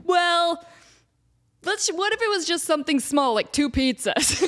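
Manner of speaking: hint of a wheedling friend, slowing at the end; mocking friends. Imitating friends bargaining for pizzas, lightly wheedling. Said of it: "And they're like".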